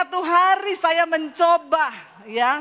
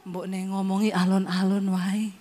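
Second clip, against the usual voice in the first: lower, softer husband voice; husband's low voice; 6 semitones lower, 4 dB quieter than usual. Retold or imitated. Husband voiced, asks slow speech.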